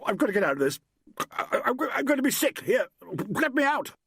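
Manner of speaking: high, stammering desperation to get out; queasy panic climbing very high; panicked. A narrator's reading. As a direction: stumble over the words as nausea takes over. Man about to be sick, fleeing.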